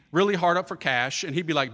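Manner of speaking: ordinary talk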